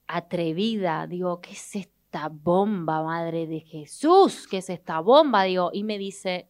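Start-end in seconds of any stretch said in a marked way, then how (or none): 1.4-5.4 exclamación fuerte, lenta y maravillada; grítalo como quien ve una bomba; asombro exagerado. Retold or imitated. Asombro al conocer a Sofía. (asombro fuerte y lento)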